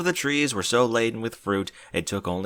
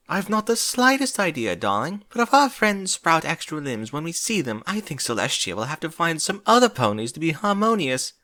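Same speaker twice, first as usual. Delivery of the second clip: dramatic Rarity; 6 semitones higher than usual. Acted.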